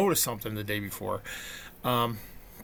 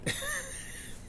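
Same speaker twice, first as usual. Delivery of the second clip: high laugh; 10 dB quieter than usual. Real, not acted. Laugh after wife's triumph.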